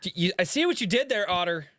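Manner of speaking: high, loud teasing squeak; mock accusing. Teasing the author Otter in a high squeaky voice. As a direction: point the finger at the author in a squeaky voice.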